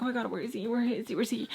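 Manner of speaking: soft, fretful muttering, acted; hushed mock panic, repeating anxiously; retold freaking out. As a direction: replay an anxious inner panic under your breath. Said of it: "I'm, like, freaking out".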